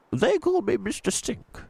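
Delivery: put-on gentle old tramp voice, a different timbre; old tramp Mister Stink. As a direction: speak like a kindly old vagrant.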